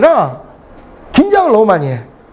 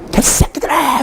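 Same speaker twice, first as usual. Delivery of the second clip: higher-pitched retold swearing; mock cursing outburst; 5 semitones higher, 3 dB quieter, slower than usual. Lecturer swearing at his students for fun, mock outburst. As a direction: curse them out in mock anger. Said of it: "막 이러면서 막 그러는데".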